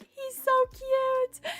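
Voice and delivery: high, slow cooing over the dog; gushing stranger. Retold or imitated. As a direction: coo it out like a smitten passer-by. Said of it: "they're like".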